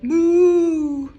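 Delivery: loud high mooing cow; big cow moo. Acted. Librarian reading aloud booms the cows' moo. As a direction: belt out a cow's moo.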